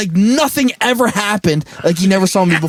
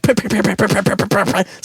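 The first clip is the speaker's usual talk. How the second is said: camera click sounds; 3 semitones higher than usual. Retold or imitated. Imitating camera shutter clicks.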